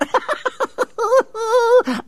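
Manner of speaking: high, loud mad cackle; demented cackle. Acted. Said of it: "Cackling with demented glee".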